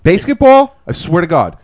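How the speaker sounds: loud, high-pitched outburst of enthusiasm; emphatic rave. Real, not acted. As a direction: shout it out, swearing by it. Raving about a panned movie.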